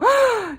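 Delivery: loud, high imitated gasp; mock shocked gasp. People hearing her cancer worry, big mock gasp. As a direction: gasp in exaggerated horror.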